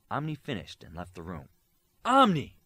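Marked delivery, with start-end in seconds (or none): 2.0-2.6 loud high call down the hall. A narrator's reading. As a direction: shout his name to stop him. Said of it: "cried a voice".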